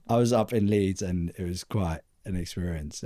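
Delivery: usual speaking voice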